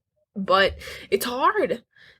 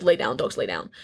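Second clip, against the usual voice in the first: low firm voice telling dogs to lay down; dog command; 10 semitones lower than usual. Retold or imitated. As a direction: order the dogs down firmly.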